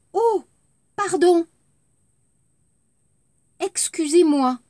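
high, bright "pardon" with rising tune; lively apology intonation. Shown as a demonstration. Teacher demos apology intonation for the class.

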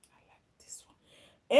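breathy whispered aside, voice dropped low. Retold or imitated. Host whispering her mother's pick to the viewers. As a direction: lean in and whisper it like a secret.

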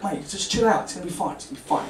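soft calming hush; soothing murmur. Retold or imitated. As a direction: settle him down gently. Mate calming him down.

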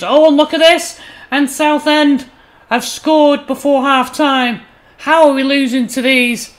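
loud, high exclamation of dismay; dismayed outburst. Real, not acted. Commentator watching his team concede before half-time, loudly dismayed.

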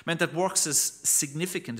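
ordinary talk

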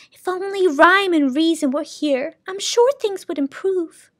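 high, gentle lady's voice; high, thoughtful sound keeper. Acted. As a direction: muse wistfully in a high voice.

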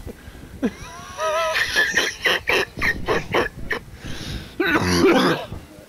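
shrieking high laugh; helpless high-pitched cackle. Real, not acted. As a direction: let out a wild squealing laugh. Cackling after sex joke.

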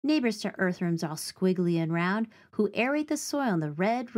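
plain reading voice

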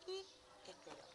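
plain reading voice

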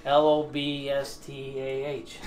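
"L O B S T A H" spelled out slowly, laughing; slow letter-by-letter Boston spelling. Shown as a demonstration. Cook spells his accent for teasing friends, slow and laughing. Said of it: "I know how to say lobster".

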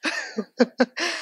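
short high laugh at her own joke; a quick amused laugh; light laugh. Real, not acted. Speaker laughing at her own pizza joke, a quick high laugh.

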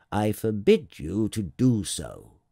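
slow, weighty royal ban; forbidding king. Acted. King forbids the little prince to yawn, slow and commanding. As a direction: forbid it with measured authority.

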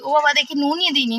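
normal talking voice

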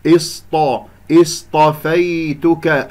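slow chanted اصطفيتك with emphatic consonants; heavy saad and taa. Shown as a demonstration. Teacher demonstrating emphatic letters, slow heavy اصطفيتك. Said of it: "الطاء بعدها مفخمة مرتبة ثانية".

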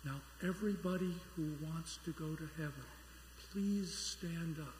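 hushed, measured pulpit request; very quiet minister. Acted. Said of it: "very quietly".

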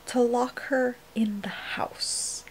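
slow, indignant whisper. Real, not acted. Ranting to viewers about Tamlin, whispered. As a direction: drag the words out under the breath. Whispering while parents home.

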